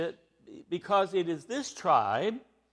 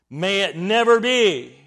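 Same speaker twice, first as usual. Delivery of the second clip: never punched hard and louder; emphatic denial; 8 dB louder than usual. Retold or imitated. Paul answering his readers with an emphatic denial. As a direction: hit never firmly and loudly. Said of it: "very emphatic".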